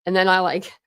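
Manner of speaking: usual speaking voice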